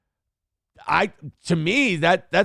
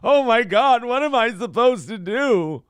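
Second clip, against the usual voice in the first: mock panic; 7 semitones higher than usual. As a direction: play the helpless victim. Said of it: "This isn't like".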